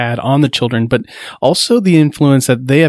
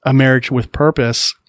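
ordinary talk

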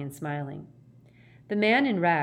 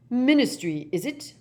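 higher, pointed, suspicious question; acted confrontational elder; challenging old man; 6 semitones higher, 7 dB louder than usual. Older man looking down at the fallen official, challenging.

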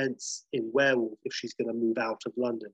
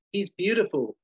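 lightly voiced nurse, pitched higher; a hint of a nurse, higher than usual; 7 semitones higher, 3 dB louder than usual. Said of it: "We hear the nurse".

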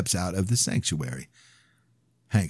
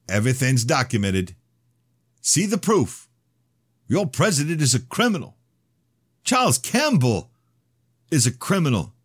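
high, loud fervent preaching; 9 semitones higher, 4 dB louder than usual. Acted. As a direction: preach it like a fire sermon. Broadcast accusation.

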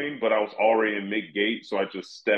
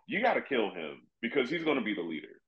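normal talking voice

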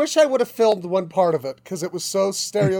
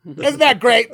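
raised, mock-proud music teacher voice; 4 semitones higher, 6 dB louder, slower than usual. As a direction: gush over the racket like an old New York teacher.

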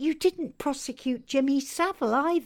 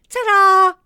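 high loud sing-song goodbye; gleeful farewell; 10 semitones higher, 13 dB louder than usual. Acted. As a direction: sign off with a flourish. Villain's farewell.

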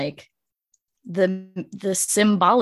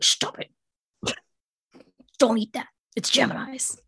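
guard cat; 3 semitones higher than usual. Retold or imitated. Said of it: "little cat standing guard at the pantry".

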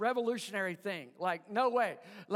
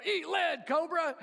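toy soldier voice box; 6 semitones higher than usual. Retold or imitated. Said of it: "and you'll go".

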